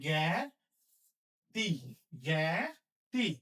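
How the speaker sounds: জ্ঞাতি spelled out slowly, drawn out; slow dictation. Shown as a demonstration. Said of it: "Chandrabindu হবে".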